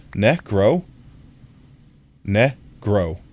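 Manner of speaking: "Negro" with a short e in the first syllable; trying Spanish pronunciation. Shown as a demonstration. Streamer retrying the Spanish color word for chat, short vowel. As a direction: sound out "Negro" with a short first vowel, tentative then settled. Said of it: "I was trying to pronounce the O".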